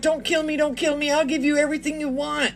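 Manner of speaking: high, pleading mock victim; mock scared pleading. Mocking how scared robbery victims beg for their lives. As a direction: beg like a frightened hostage, half-joking. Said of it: "They're scared and they're like".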